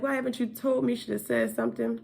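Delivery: high, lightly voiced reproachful social worker; imitating social worker. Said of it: "she was like".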